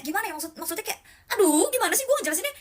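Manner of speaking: exasperated. Real, not acted. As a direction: throw up your hands at the words. Frustrated, cannot explain it. (frustrasi, nada jauh lebih tinggi dan cepat)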